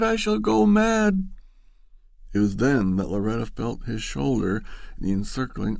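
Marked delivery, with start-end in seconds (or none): 0.0-1.3 higher and louder, breaking out; rising to a cry. Acted.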